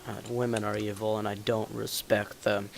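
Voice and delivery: low, soft deadpan act; mock confession. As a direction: drop low and quiet, mock-serious. Puts on a deadpan misogynist act.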